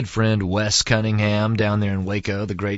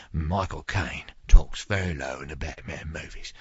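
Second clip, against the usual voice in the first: a lower, heavier Caine impression, not quite as soft; lower Michael Caine voice; 5 semitones lower, 3 dB quieter than usual. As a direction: keep the Caine voice low but fuller. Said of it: "now he talks very low".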